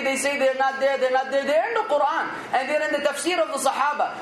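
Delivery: ordinary talk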